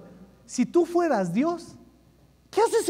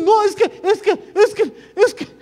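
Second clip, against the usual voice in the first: high, halting, repeated false starts; flustered stammer; 8 semitones higher, 4 dB louder than usual. Acted. Moses making excuses to God, flustered stammer. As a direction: stall with high-pitched stuttered excuses.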